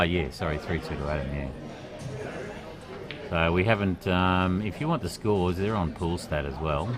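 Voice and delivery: ordinary talk, nothing marked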